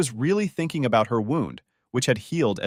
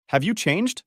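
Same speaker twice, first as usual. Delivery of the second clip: higher-pitched, mildly surprised; surprised question; 7 semitones higher than usual. A narrator's reading. Girl asks the boy about himself, surprised question. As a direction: ask it with a lift of surprise. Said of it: "the girl asked in surprise".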